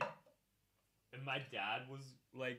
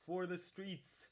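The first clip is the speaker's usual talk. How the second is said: drawn out slowly; slow, higher, drawn-out rally cry; slow dramatic; 5 semitones higher, slower than usual. Pretend. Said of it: "repeated rallying line".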